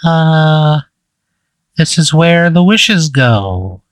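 high, slow creature voice; wish creature. Acted. Game master repeating the creature's only answer to player, high slow creature voice. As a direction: intone it high and drawn out, like a strange creature. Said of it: "So it just says".

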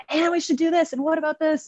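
high-pitched, eager burst of ideas; eager pitching. Retold or imitated. Younger self bursting into a room with ideas, high and eager. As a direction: toss ideas out in a bright, high voice.

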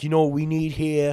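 broad mock-Italian mob accent; Italian wiseguy accent, playing it up; Italian mobster accent. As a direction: do a hammy Italian-American gangster. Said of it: "I was doing Italian".

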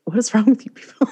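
fast, annoyed huff; irritated mock quote. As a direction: snap it out fast like a fed-up teen. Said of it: "Samantha is very irritated".

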